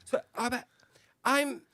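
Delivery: high, hesitant mock puzzlement; halting high-pitched impression of someone baffled; imitated confusion. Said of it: "but you were like".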